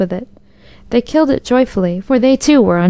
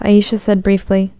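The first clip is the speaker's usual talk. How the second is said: ordinary delivery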